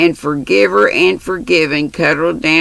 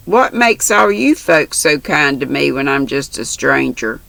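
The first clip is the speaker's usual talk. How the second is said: ordinary delivery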